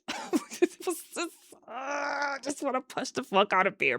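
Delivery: high, strained exasperated venting under the breath. Real, not acted. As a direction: squeeze out the frustration, high and soft.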